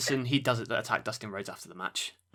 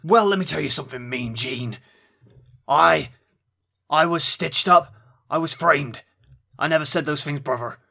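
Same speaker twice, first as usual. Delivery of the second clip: louder, higher mock wrestler promo; Hulk Hogan impression; 4 semitones higher, 6 dB louder than usual. [name] pressed for his Hulk Hogan impression, loud mock promo. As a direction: bellow a defensive wrestling promo. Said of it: "we need to hear your Hulk Hogan impression".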